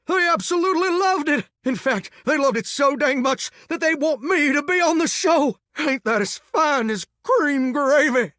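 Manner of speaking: high, elated gushing; overjoyed, high-pitched reveal; thrilled announcement. Acted. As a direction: pitch it way up, stretching the final boast. Reveals thrilling good news.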